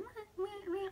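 very high imitated puppy whimpering. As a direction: mimic a hurt dog's whimper. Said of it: "like, whimpering".

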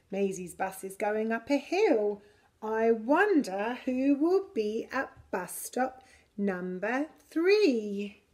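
plain reading voice

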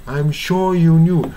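non-rhotic British "sure"; British-style sure. Shown as a demonstration. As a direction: draw out "sure" with no r. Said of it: "ブリティッシュっていう感じ".

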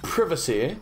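"Privacy" with a short i as in "privet", questioning lift; British short-i privacy. Shown as a demonstration. Player echoing the game character, British "Privacy" with short i. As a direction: say "Privacy" the British way, PRIV-uh-see. Repeats game's word, comparing pronunciations.

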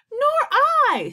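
high, loud outcry; alarmed high exclamation; panicked chiming in. A narrator's reading. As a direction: join the wailing at a high pitch. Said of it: "said Miss Spider".

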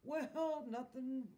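big, high-pitched announcement; booming. A narrator's reading. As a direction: boom it out to everyone. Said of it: "he boomed".

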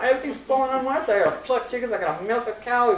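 ordinary delivery

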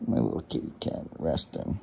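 soft unvoiced whisper; hushed whisper over a resting cat. Real, not acted. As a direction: murmur it so the cat keeps dozing.

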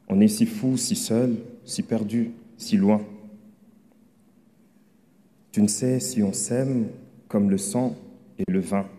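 measured poem recital with pauses between phrases; poem reading. Acted. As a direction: read your love poem out, phrase by phrase. Continues reading own poem aloud.

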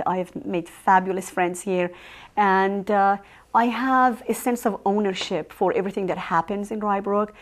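normal talking voice

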